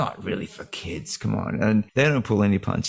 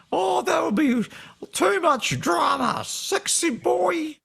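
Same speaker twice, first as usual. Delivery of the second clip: very high, slow, campy falsetto; drawn-out, flamboyant squeal of a voice; high camp character voice; slower than usual. Retold or imitated. Voicing a character's line.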